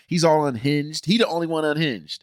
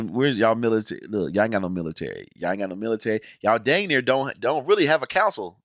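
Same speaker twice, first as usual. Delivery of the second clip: ordinary talk, nothing marked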